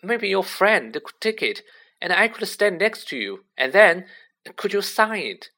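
high, eager pleading; imploring. A narrator's reading. Colin begging Harry for a photo, imploringly. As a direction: plead hopefully, pitched well up. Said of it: "He looked imploringly at Harry".

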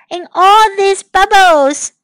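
high, slow stretched-out call; calling back. A narrator's reading. Amanda answers from inside the bubbles, high slow call.

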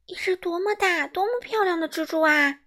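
high, delighted exclamation; a boy thrilled by a big spider; excited. Acted. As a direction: marvel at it, thrilled. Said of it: "他兴奋地说".

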